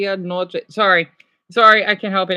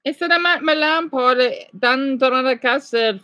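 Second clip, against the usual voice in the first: a light put-on Tagalog accent, higher; 4 semitones higher than usual. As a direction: thank viewers in a mock Tagalog accent. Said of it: "I'm trying to talk in a Tagalog accent".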